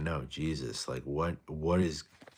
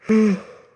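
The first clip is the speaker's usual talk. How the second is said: loud weary sigh; 14 dB louder than usual. Real, not acted. Heavy sigh on stream.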